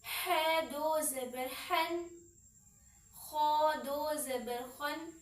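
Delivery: slow drill. Shown as a demonstration. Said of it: "है को हमने है नहीं पढ़ना".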